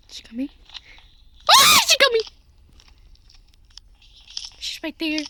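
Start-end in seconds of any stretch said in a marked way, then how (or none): 1.5-2.2 panicked cry. Real, not acted. Granny appears.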